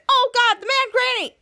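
hammed-up fright; mock alarm. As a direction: panic at the sight of the villain. Captain Man sees the grandma.

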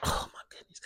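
breathy hushed whisper; stunned whisper. Real, not acted. Recalling the scary dream to viewers, whispering. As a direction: let it out under your breath.

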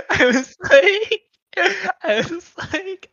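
high, slow, laughing through the words. Real, not acted. Telling a friend the story, laughing so hard the voice cracks high. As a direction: crack up while trying to talk.

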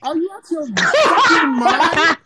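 high, loud, slow disbelief through a laugh; laughing outrage. Real, not acted. Fan defending Jinder yelling at cohosts through laughter. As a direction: yell it while cracking up.

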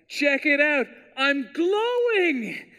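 high, excited bragging voice; mock show-off Jesus. Pastor mocking a bragging Jesus to the church, high and giddy. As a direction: preen like a kid showing off a glow trick. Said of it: "that's not really in the Bible at all".

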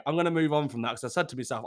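ordinary talk, nothing marked

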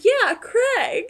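high, loud imitated confirmation; Sonia. Retelling Sonia confirming who she kissed, high loud impersonation. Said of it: "And she goes".

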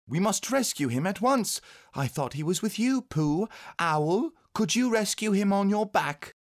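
put-on high-pitched, anxious child's voice; a boy urging a rescue, high and pressing; urgent young boy. A narrator's reading. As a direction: voice a worried little boy, high.

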